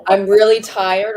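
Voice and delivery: ordinary talk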